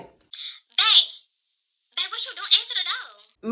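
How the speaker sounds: very high put-on girly voice; Phony. Impatient Phony phoning her man at the door, high mock voice. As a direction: pitch it way up, mocking her phone call. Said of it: "she's still talking in that little, uh, phony Louisiana accent".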